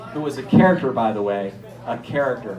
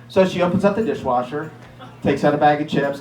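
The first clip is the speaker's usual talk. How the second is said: usual speaking voice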